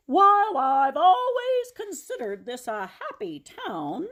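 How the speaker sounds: high, loud pulpit voice with a laugh; rally the town from the pulpit; preaching pastor. Acted. Pastor addresses town meeting.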